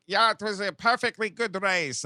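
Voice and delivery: high, put-on German accent; mock German-accented politeness; Til Schweiger. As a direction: imitate a German driver being gracious. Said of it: "Til Schweiger has to be like".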